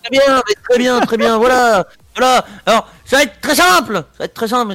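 high, loud showman opener; hyped host. Real, not acted. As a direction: whip up the crowd. Host hyping the game. (animateur survolté)

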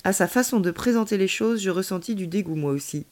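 ordinary delivery

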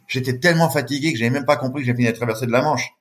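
normal talking voice

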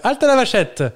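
high, loud announcer cry; show catchphrase. Retold or imitated. Host quoting the game show catchphrase, high loud cry. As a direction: call it out like the TV host.

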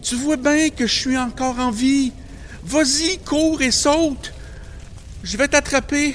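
high, loud pleading call; urgent coaxing. Acted. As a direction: urge him on, promising to catch. Pleading brother to jump. (encouragement pressant, aigu et fort)

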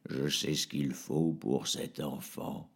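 low, slow, otherworldly possessed doctor; disembodied voice. Acted. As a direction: speak like something hollow speaking through him. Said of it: "Il dit d'une voix désincarnée". (voix désincarnée, grave et lente)

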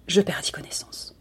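soft unvoiced whisper; whispered fainting. A narrator's reading. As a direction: breathe it out as you pass out. Narrator fainting.

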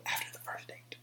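hushed whisper for a scandalous idea. Real, not acted. Host whispers the wild premise to listeners. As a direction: lean in and whisper it.

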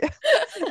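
sudden high laugh; high burst of laughter. Real, not acted. Laughs at own joke.

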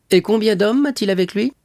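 high, loud royal question; urgent demand. A narrator's reading. King demands the troop numbers, high and urgent.

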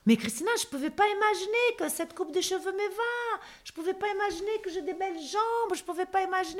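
high, soft, wonder-struck client voice; amazed client. Retold or imitated. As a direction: gush in awe like a client seeing herself. Clients amazed before the mirror.

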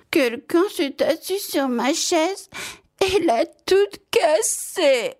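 very high, slow, tearful little-bear squeak; tiny fluty voice. A narrator's reading. Tiny bear finds his chair broken, high fluty wail. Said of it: "de sa toute petite voix flûtée".

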